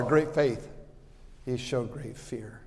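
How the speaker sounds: normal talking voice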